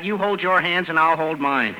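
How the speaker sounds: ordinary delivery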